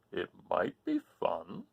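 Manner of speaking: slow, sleepy turtle drawl. Acted. Turtle answering the rooster, slow and sleepy. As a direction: let it crawl out like a lazy turtle.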